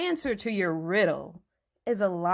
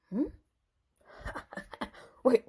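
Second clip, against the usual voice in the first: usual speaking voice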